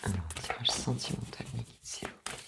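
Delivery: whispered request to spirit guides; soft reverent whisper. Real, not acted. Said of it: "whispered request to guides".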